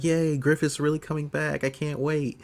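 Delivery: small, high-pitched mock glee; mock excited cheer. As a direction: lightly act out eager delight. Voices Rickett happy at the news.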